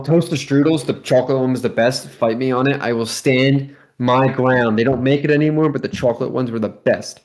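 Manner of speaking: ordinary talk, nothing marked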